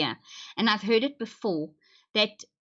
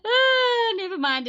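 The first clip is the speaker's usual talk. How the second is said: laughing; 13 semitones higher, 5 dB louder, slower than usual. Real, not acted. Laughing outburst.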